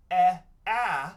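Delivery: "e, a" as two separate short sounds, loud; short vowel sounds. Shown as a demonstration. Teacher shows the wrong vowels, loud short "e, a". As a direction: punch "e, a" apart as eh and aah. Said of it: "So instead of".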